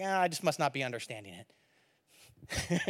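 inner thought. Retold or imitated. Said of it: "and I'm like".

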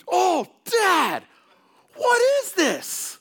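put-on high squeaky child's voice, drawn out slowly on the last words; a small kid who just tasted something awful; dad imitating his young daughter spitting out fake soda; disgusted little girl. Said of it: "she goes".

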